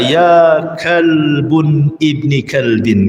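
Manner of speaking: slow, drawn-out Arabic phrase; slow, gentle fatherly correction; gentle reproof. Retold or imitated. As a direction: stretch each word out, unhurried. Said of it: "ucapkan dengan kalimat yang lembut".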